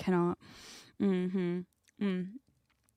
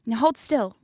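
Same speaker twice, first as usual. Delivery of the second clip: louder, higher hammed-up protest; mock defiance; 4 semitones higher, 7 dB louder than usual. Host refusing onlookers, sledgehammer in hand, loud mock defiance. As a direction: insist loudly, like a hammed-up villain refusing to stop. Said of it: "And I'm like".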